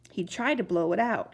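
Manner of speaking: plain reading voice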